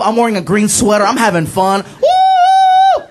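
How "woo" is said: put-on very high drunken whoop; loud woo. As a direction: let out a party-goer's piercing woo. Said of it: "I started getting loud".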